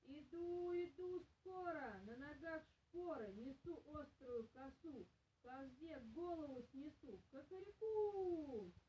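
high, slow rooster crow; a rooster calling out boldly, dragging the last crow; rooster's crowing call. Acted. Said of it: "да и как закричит".

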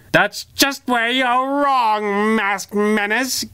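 high, slow, taunting villain voice; gloating villain, high and drawn out; villain's shrill sneer. Acted. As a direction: drag out the taunt, high and mocking. Villain defiant to hero.

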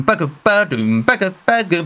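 mouth-beat ba-ga ba-doom; beatboxed beat. Retold or imitated. Vlogger imitating the song's beat with mouth sounds. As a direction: tap out the drum beat with your voice. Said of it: "the beat itself goes boom".

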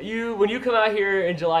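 ordinary talk, nothing marked

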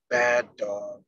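normal talking voice

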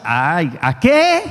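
scoffing reluctance. Acted. Churchgoer scoffing at mass.

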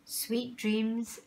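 ordinary delivery, nothing marked

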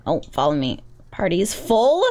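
ordinary talk, nothing marked

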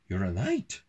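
usual speaking voice

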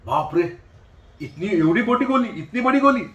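loud, rising mock alarm; acted alarmed Hyoscyamus patient. Hyoscyamus patient to doctor about a big pill, alarmed. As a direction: exclaim in exaggerated shock at a huge pill. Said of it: "hyoscyamus भी ऐसे करेगा".

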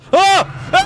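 sudden high scream of fright; startled scream. Real, not acted. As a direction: yelp in shock. Jump scare in game.

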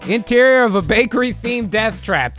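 ordinary talk

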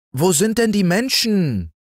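put-on high little boy's voice, calling upward; child calling up. Little Häwelmann calling up to the rooster, high child voice. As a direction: call up to the rooster like an eager small boy. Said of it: "rief der kleine Häwelmann hinauf".

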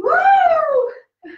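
loud, high-pitched "woo"; high whoop. Real, not acted. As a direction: whoop it out at full energy. Whooping through star jumps.

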